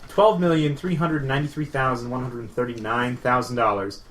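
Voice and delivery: usual speaking voice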